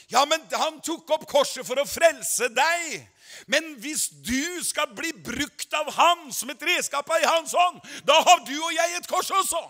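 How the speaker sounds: building to a shouted climax; preaching at full force. Acted. Preacher rousing the congregation, building to a shout.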